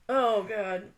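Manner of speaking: normal talking voice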